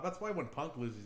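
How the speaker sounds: normal talking voice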